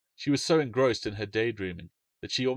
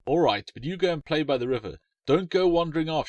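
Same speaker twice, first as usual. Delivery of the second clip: higher, lightly voiced girl's warning; warning big sister; 6 semitones higher, 5 dB louder than usual. A narrator's reading. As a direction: caution him like a bossy older sister. Alice warns brother.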